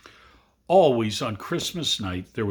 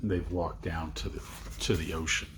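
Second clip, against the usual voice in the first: hushed; 6 semitones lower, 8 dB quieter than usual. A narrator's reading. Hushed aside.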